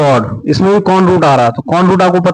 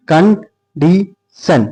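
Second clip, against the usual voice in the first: slow drill; 4 semitones higher, much slower than usual. Shown as a demonstration. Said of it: "teacher drills the new word".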